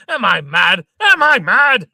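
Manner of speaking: raging, loud and high; demanding an answer at full volume; furious shout. Acted. Enraged father demanding an answer.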